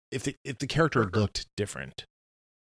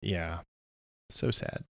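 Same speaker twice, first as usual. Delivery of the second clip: mock weeping, soft and breathy; fake-cry it softly; mock sad; 4 semitones lower, 6 dB quieter, slower than usual. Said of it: "He's crying here".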